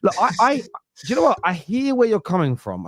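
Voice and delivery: normal talking voice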